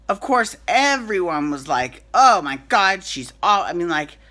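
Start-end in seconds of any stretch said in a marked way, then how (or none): 2.1-3.6 loud, outraged "Oh my God"; excited gasp. Retold or imitated. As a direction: burst out in shock. Forresters reacting in outrage.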